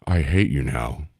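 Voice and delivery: low, deadpan celebrity impression. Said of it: "he kinda looked up and he goes".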